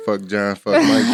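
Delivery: half-laughing mock rant; mock yell. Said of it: "hear me saying".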